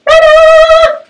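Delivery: very high, loud ta-da; big ta-da. Pretend. Showing the new curtain off, a very high loud ta-da. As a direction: throw out a grand reveal.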